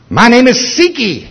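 lifted, eerie voice unlike his own; high demon voice. Retold or imitated. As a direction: let the demon taunt from up high. Demon names itself.

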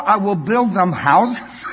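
put-on slow, high Afrikaans-accented reply, laughing; calmly trump him in a heavy Afrikaans accent. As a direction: Brahm answering the excuse in Afrikaans accent. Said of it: "I'm gonna use his accent now".

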